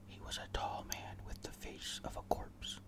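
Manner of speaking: usual speaking voice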